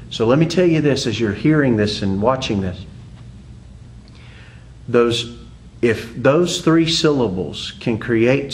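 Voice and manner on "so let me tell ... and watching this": ordinary talk, nothing marked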